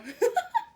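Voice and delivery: a sudden high burst of laughter; giggling at her own joke. Real, not acted.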